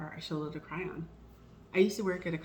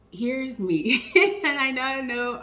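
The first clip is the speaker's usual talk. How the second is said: louder, higher, laughing through the words; 8 semitones higher, 9 dB louder than usual. Real, not acted. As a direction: laugh at yourself, then own it. Laughing at herself.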